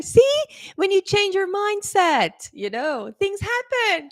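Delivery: high-pitched triumphant "see?"; proudly prove your point, pitch lifted; excited, high I-told-you-so; retold told-you-so.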